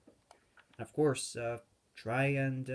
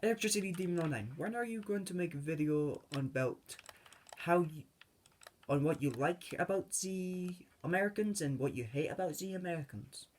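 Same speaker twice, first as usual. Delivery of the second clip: higher-pitched mock viewer pestering with a request; imitated commenter; 5 semitones higher than usual. Viewer comment asking for a video, high imitated voice. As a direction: pitch it up as a nagging fan. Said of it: "there's comments like".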